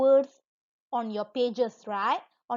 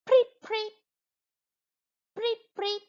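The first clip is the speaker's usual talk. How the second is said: high, sharp preet; teacher imitating a police whistle; whistle imitation; 9 semitones higher than usual. Shown as a demonstration. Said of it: "what is the whistle sound?".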